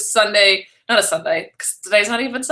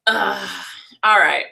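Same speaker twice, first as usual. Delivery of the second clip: usual speaking voice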